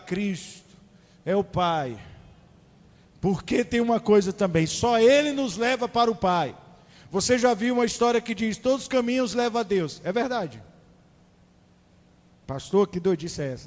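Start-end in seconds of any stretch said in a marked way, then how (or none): 3.2-10.0 higher and louder sermon voice; preaching at full force. Real, not acted. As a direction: drive the point home from the pulpit.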